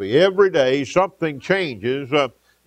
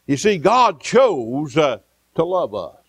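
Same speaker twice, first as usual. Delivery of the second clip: normal talking voice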